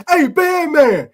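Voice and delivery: high mock holler from the sideline; imitating a coach hollering at the band man. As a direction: yell up at the band like an angry coach. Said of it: "Our coach used to be hollering up in the stand".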